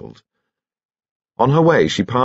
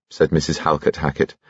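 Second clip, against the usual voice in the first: plain reading voice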